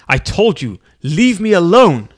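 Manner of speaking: loud snapping leave me alone; angry rebuff. A narrator's reading. Feldman shouting at Lefkowitz to go away, loud. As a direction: shove him off with a loud snap.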